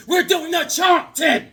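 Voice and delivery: high, loud, slow shout; gleeful shouted announcement; excited shout. Real, not acted. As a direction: yell it like a hype man. Hyping the comic.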